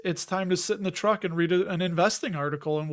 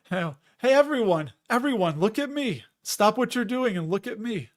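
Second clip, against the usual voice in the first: mock attention-begging, higher pitched; attention-seeking show-off; 4 semitones higher than usual. Imagined Lambo driver calling to everyone around, mock attention-seeking. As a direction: call out for everyone's eyes, mockingly. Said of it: "I feel it's like somebody saying".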